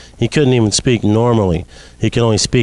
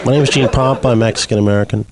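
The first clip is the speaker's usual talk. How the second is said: ordinary delivery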